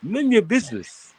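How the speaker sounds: high-pitched mock African accent. Man practising his accent for friends, high mock African accent. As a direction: toss out a line in a put-on African accent. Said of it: "That's my best accent".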